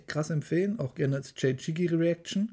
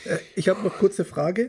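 laughing; 5 semitones higher, 4 dB louder than usual. Real, not acted. Still laughing after joke.

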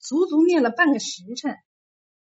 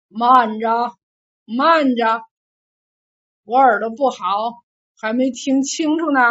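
teasing command; 5 dB louder than usual. A narrator's reading. General toys with the kneeling monk.

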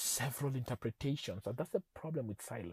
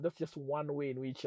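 ordinary delivery

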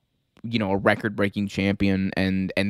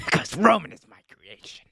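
whispery, strained character voice with dragged-out words; disgusted boss impression. Acting a boss mocking a wrestler.

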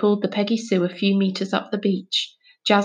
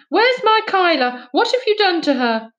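shouting; 10 semitones higher, 4 dB louder than usual. Acted. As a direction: yell for her missing daughter. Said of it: "she was shouting".

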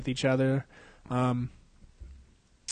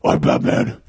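loud, rasping whisper-growl; over-the-top throaty Batman; 6 dB louder, slower than usual. Acted. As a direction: force it out as a hoarse superhero.

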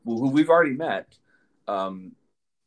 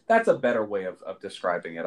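ordinary delivery

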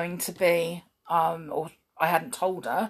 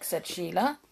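ordinary delivery

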